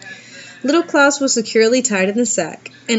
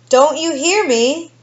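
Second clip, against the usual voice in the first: slow, higher, straining call; shouting his loudest; 7 semitones higher, slower than usual. A narrator's reading. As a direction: shout to be heard by the dead woman. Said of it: "the innkeeper shouted his loudest".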